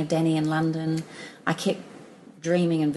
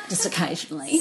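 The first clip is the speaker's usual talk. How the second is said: laughing; 10 semitones higher than usual. Real, not acted. Laughs at own advice.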